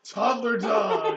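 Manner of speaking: slow, laughing disbelief; incredulous laughing. Real, not acted. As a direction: repeat it as you crack up. Incredulous repeat of absurd phrase, laughing.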